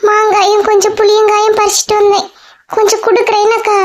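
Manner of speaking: put-on young Geetha.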